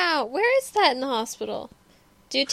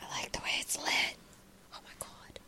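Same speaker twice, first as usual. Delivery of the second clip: awed soft whisper; 17 dB quieter than usual. Real, not acted. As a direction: breathe it out in awe. Watching quietly, awed.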